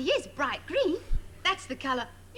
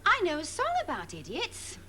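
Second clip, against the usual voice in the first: ordinary talk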